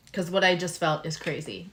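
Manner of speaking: usual speaking voice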